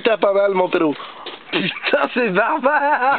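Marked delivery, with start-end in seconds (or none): 1.5-3.2 horrified. Real, not acted. Watching tooth pulled, horrified.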